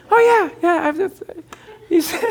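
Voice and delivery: high, broken by laughter; can barely get the words out for laughing; laughing through it. Real, not acted. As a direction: still cracking up while quoting the man. Laughing while retelling the man's reply.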